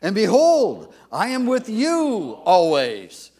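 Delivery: booming congregational read-aloud; loud scripture reading. Shown as a demonstration. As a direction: proclaim it to the congregation. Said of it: "Let's read it aloud together".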